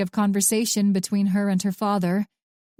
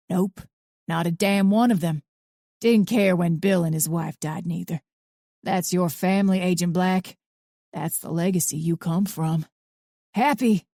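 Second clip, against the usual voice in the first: put-on gruff old man. A narrator's reading. Arthur's voice.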